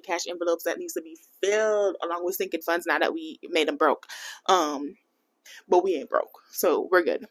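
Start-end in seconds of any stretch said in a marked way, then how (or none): none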